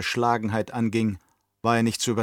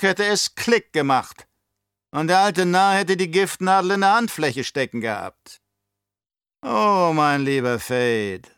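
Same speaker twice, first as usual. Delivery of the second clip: put-on higher, louder, oily old Baron voice; scheming old Baron; 8 semitones higher, 5 dB louder than usual. Baron to his nephew, raised and sly. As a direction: play the cunning uncle sounding out his nephew.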